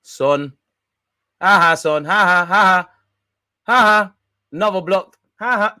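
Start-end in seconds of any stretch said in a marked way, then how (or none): 1.4-5.8 high, loud taunting laughter; mocking laugh. Real, not acted. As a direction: cackle at the miss. Mocks Son's blocked shot.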